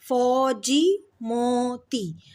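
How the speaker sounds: फौजी, मोती slowly with long vowels; drawn-out syllables. Shown as a demonstration. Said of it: "ओ का sound आ रहा है".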